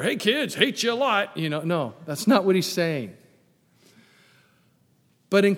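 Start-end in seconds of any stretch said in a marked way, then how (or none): 0.0-1.4 mock phone call. Pretending to tell the kids after service, hammed-up.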